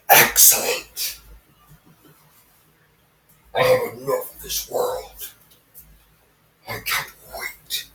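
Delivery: slow, hissed villain whisper; evil voice. Acted. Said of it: "I'm trying to make the evil voice".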